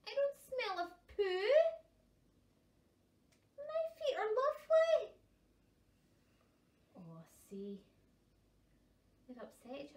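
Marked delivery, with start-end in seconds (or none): 0.1-5.2 high, loud offended protest; indignant puffin. Acted. Insulted puffin defending himself, loudly offended. 7.0-7.8 soft unvoiced aside; whisper. Reader confiding to listening children, whispering.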